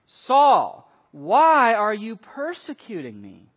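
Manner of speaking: loud and high, calling out Saul; booming divine voice. Retold or imitated. Voice from heaven confronting Saul, booming call. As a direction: call Saul's name like thunder from heaven. Voice of Jesus quoted loudly.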